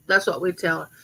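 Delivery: ordinary delivery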